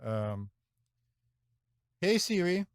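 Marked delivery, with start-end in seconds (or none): none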